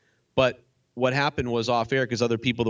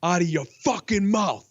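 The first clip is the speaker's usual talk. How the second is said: slow, higher menacing Will Smith warning; imitating Will Smith; 5 semitones higher, slower than usual. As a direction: lay down the threat slowly, pitched up. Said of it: "Will Smith was like".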